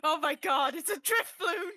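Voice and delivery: high, loud burst of delighted recognition; thrilled outburst; excited realization. Real, not acted. Players recognise the drawn figure.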